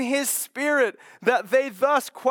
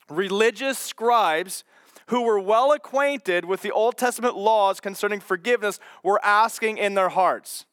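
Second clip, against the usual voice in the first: ordinary delivery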